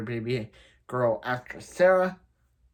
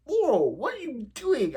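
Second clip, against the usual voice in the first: much higher, mock-cheerful "Laurel, what are you doing?"; oblivious dad; 12 semitones higher than usual. Father calling to his daughter Laurel, cheerfully oblivious. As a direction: play the clueless father, breezy.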